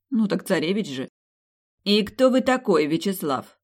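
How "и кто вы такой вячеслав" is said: строгий допрос матери, заметно выше и громче; требовательно, сверху вниз; строго. A narrator's reading. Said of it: "строго спросила мама". (мать строго допрашивает жениха дочери)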